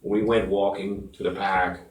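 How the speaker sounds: put-on low, quiet Massachusetts accent with a flattened "park". Radio host hamming up local talk for co-host, Massachusetts accent. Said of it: "solid Massachusetts".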